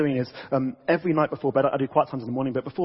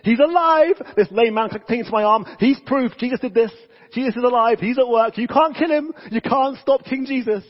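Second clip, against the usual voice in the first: much higher and louder, ringing with triumph; Peter's triumphant cry; 8 semitones higher, 6 dB louder than usual. Retold or imitated. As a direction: proclaim it like Peter defying the crowd. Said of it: "He's like".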